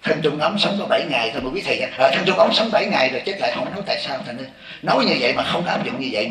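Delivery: usual speaking voice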